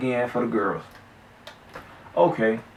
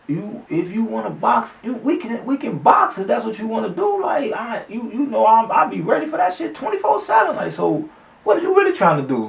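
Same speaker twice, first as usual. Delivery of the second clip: mock heated challenge to the loudmouth friend; challenging shout; 11 semitones higher than usual. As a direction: call him out to box, fired up.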